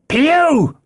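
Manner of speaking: high call from a window; high-pitched hail; cried out. A narrator's reading. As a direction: holler the name down to the road. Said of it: "he cried".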